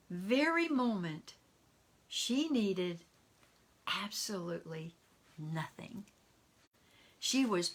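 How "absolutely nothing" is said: hushed reveal. A narrator's reading. Hushed climax of the story.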